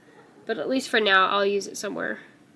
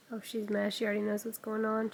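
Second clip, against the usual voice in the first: normal talking voice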